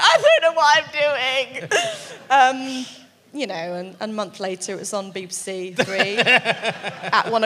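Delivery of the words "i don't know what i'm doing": high helpless voice. Retold or imitated. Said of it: "And I'm like".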